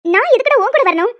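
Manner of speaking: woman refusing to go with the harasser, outraged; indignant outburst. Acted. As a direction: fling the question back at him.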